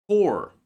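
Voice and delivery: Core said slowly and clearly as kor; careful core. Shown as a demonstration. Teacher modelling Core for students, clear and careful. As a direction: pronounce Core with a silent p, like kor. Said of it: "this word is pronounced as".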